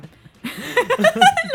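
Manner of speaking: carcajada larga, aguda y fuerte. Real, not acted. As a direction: se tenta y estalla en risa. Risa ante comentario fuerte. (carcajada larga y aguda)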